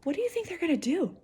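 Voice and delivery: ordinary delivery, nothing marked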